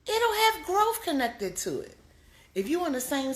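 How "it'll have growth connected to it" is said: high, slow and stressed; emphatic repeat. Real, not acted. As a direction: lean on every word. Repeated emphatic sermon line.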